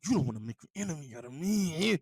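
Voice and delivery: a light mock of the pundit's tough warning; imitating Stephen A. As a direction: play the pundit's own threat back at him, lightly. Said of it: "That's how you've been talking".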